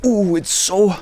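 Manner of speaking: high, pained chili-eater impression; Chili Klaus. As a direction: imitate someone loving it through the burn. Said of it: "that sounds like the Chili Klaus guy".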